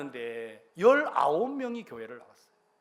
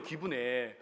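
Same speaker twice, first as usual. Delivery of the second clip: ordinary delivery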